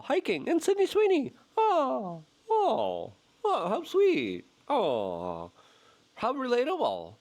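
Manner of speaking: high, mocking "how sweet" dragged out; sarcastic fawning. Real, not acted. Mocking Vance's podcast topics, high sarcastic coo. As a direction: sneer in fake delight.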